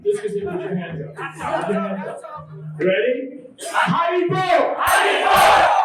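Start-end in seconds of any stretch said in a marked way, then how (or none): 3.6-5.8 loud rising chant, high and stretched; rally the crowd with the call; call-and-response shout. Real, not acted. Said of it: "When I say Haribo, you say Haribo".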